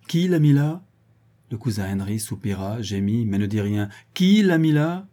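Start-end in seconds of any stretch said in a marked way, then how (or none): 0.1-0.8 higher-pitched interrogating question; pressing question. A narrator's reading. Lawyer questioning cousin Henry about the will, pressing. 4.1-5.1 high, loud repeated demand; insistent demand. A narrator's reading. Lawyer asking silent cousin Henry once more, insistent demand. Question repeated after no answer.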